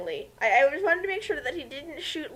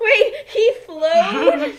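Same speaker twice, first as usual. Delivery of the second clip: slow, much higher, louder amused question; laughing disbelief; 8 semitones higher, 8 dB louder, much slower than usual. Real, not acted. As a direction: squeak out the question through laughter.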